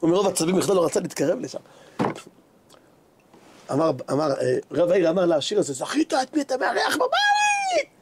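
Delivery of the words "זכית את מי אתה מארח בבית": high, slow, drawn-out cry of amazement; amazed exclamation. Retold or imitated. Town rabbi tells the host whom he hosts, amazed cry. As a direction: marvel aloud, climbing to a high cry.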